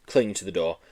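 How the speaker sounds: ordinary delivery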